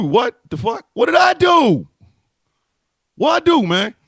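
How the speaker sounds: high, loud, genuinely baffled protest; indignant outburst. Real, not acted. Blamed by his co-host over the muted mic, he protests loudly and indignantly. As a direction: throw up your hands and yell what did I do.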